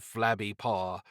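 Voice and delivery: ordinary delivery, nothing marked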